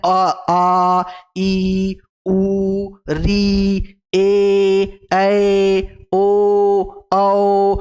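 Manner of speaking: "आ, ई, उ, री, ए, ऐ, ओ, औ" held out on a level note; stretched long vowels. Shown as a demonstration. Teacher chanting the long vowels into the mic, "आ, ई, उ, री, ए, ऐ, ओ, औ" each drawn out. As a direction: sustain each of "आ, ई, उ, री, ए, ऐ, ओ, औ" evenly. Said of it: "ये खींच रहा है".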